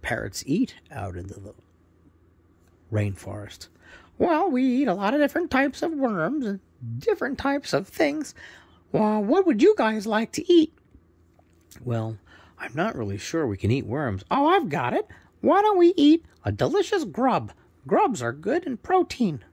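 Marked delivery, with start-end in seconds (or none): 4.2-10.7 high, loud squawky parrot; proud parrot introducing himself, high and loud. Acted. Parrot character talks. 14.3-19.4 high, loud squawky parrot; proud parrot introducing himself, high and loud. Acted. Parrot character talks.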